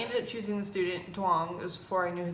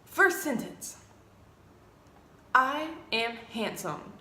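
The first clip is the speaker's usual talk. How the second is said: formal student recital. Retold or imitated. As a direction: announce each sentence with mock gravity. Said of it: "he's just like".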